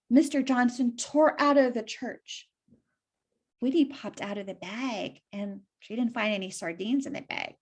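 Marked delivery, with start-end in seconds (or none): none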